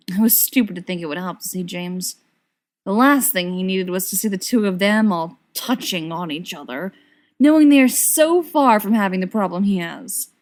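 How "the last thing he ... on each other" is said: ordinary delivery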